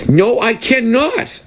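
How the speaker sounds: high, slow mock refusal; stiff refusal. Comedian repeating the clerk's odd reply, stiff refusal. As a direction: stretch each word out in a high put-on voice. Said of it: "who says it like that?".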